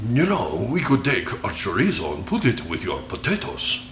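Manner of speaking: lilting, hammed-up Spanish accent; mock Spanish accent. Spanish castaway pitching chorizo to the Irish, mock Spanish accent. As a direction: put on a cartoon Spanish accent, pitch swooping. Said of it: "the Spanish Armada crashed into the shores".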